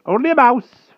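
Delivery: high, loud hasty wizard cry. A narrator's reading. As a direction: cry out to cover the noise. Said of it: "cried Merlin".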